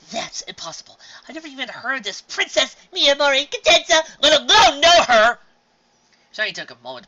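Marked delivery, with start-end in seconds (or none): 0.1-5.4 high, loud indignant protest; indignant denial. Acted. Sister insisting she never heard of the princess, indignant. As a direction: insist it can't be true. Twilight denying, still upset.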